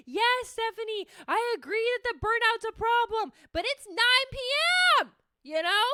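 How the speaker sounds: high, stretched-out exasperated whine. Acted. Arguing with her own brain at night, exasperated.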